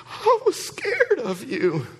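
put-on timid high child's reply; scared child. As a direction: play the frightened little boy. Frightened son answering dad.

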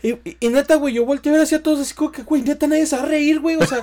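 loud and high, rushing in disbelief; excited disbelief. Real, not acted. Telling a friend about a girl spitting food mid-joke, loud and amazed. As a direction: blurt it out, amazed.

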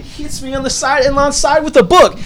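high-pitched disbelief at the climax; incredulous storytelling. Real, not acted. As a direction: squeal the punchline of the story. Climax: customer hits him with book.

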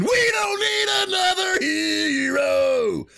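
high, slow belted shout; belting shout. Retold or imitated. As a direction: bust in belting the line. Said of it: "You usually just bust in".

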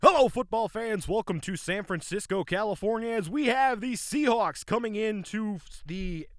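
play-by-play broadcaster voice; very professional announcer. Pretend. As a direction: welcome the fans like a real broadcast. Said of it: "like, very professional".

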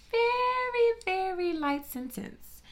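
first very shot up high and loud, then slow; indignant emphasis on the light sentence; outraged stress on very. Real, not acted. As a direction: hit very high in disbelief. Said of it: "outrage at sentence".

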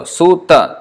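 sutta drawn out slowly; slow, clear sutta; slow syllable demo. Shown as a demonstration. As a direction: stretch sutta to show its syllables. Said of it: "three syllables there".

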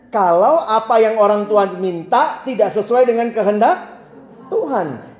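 ordinary talk

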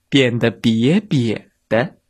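usual speaking voice

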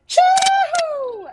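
very high, loud Polynesian celebratory whoop; piercingly high, full-voiced chee-hoo. Shown as a demonstration. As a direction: whoop a long chee-hoo across a stadium. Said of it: "chee-hoo as loud as I possibly could".

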